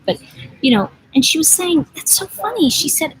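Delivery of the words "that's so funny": light imitation of a Polish woman's broken English; a hint of a Polish accent, voice lifted high. Woman from Poland at the airport, in mild Polish-accented English. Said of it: "she had a very broken English accent".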